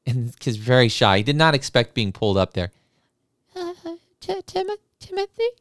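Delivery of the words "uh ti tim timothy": put-on very high, hesitant child's voice; shy little boy. Shy boy pulled up to the piano, high and hesitant. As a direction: stammer it out like a shy kid.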